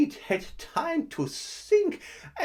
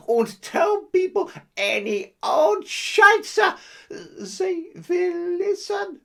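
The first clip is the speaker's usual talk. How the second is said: mock guru shout; 5 semitones higher, 5 dB louder than usual. Guru preaching his advice.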